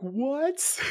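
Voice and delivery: very high, squeaky mock disbelief; re-enacted high-pitched astonishment; incredulous. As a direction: shoot up high in baffled shock. Retells shocked reaction to character's offer.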